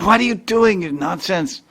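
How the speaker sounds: mother-in-law scolds her daughter-in-law, high and sharp; scolding mother-in-law. Retold or imitated. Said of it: "her mother-in-law came out and said".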